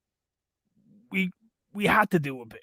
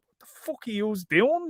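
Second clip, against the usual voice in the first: incredulous mock; 7 semitones higher than usual. Mocking the police.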